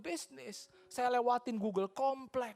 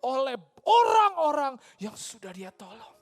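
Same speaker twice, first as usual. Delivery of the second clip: preaching at full force; 5 semitones higher, 7 dB louder than usual. Acted. Preacher telling the congregation Jesus was rejected and killed, rousing louder proclamation.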